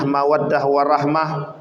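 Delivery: usual speaking voice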